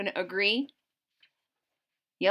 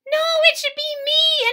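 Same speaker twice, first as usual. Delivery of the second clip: very high, louder insistent leaf voice; squeaky leaf; 7 dB louder than usual. Acted. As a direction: cut in to claim the top. Purple Beech voiced in story.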